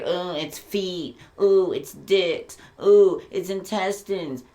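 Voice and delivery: oversell every dish with sugary scorn; sarcastic mock delight. Mocking people who praise offal dishes, slow sarcastic gushing.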